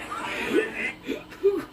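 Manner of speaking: high breathless burst of laughter; real laugh that steals the breath; wheezing laugh. Real, not acted. Streamer cracks up at a clip, wheezing laugh.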